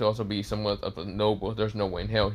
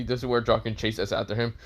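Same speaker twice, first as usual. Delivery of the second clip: ordinary delivery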